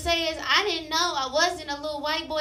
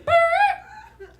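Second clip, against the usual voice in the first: high mock siren; 6 dB louder than usual. Imitated siren sound.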